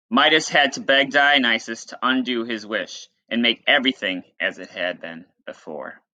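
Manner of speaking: lightly dramatic read-aloud voice; storyteller reading to students; story narration. Acted. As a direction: tell the myth to the class. Teacher reads myth aloud.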